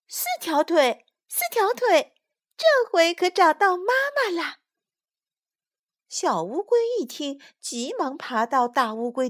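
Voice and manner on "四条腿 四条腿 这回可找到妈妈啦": put-on high, loud, delighted child voice; high-pitched joyful discovery; excited tadpoles. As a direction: burst out as a happy little tadpole. Tadpoles think they found mother.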